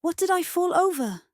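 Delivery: high-pitched exclaimed question; indignant puzzlement. A narrator's reading. Winnie has tripped over something, indignantly puzzled. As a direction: demand it in a high voice.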